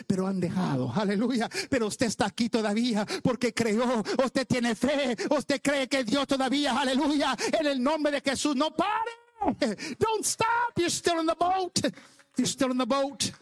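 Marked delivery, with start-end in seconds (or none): 1.7-10.8 high, driving exhortation rising to a peak; fervent preaching. Real, not acted. Preaches at full force. 10.8-11.8 high-pitched preacher's proclamation; emphatic exhortation. Real, not acted. Emphatic line, then repeated lower.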